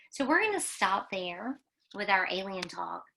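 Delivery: ordinary talk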